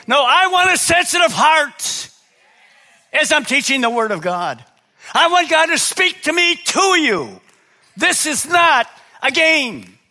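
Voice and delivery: high, loud, full-force preaching; preaching shout. Real, not acted. Pastor shouting the Word to his church, fervent and loud. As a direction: belt it out to the congregation. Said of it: "It's not about my shouting".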